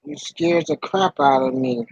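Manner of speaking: ordinary delivery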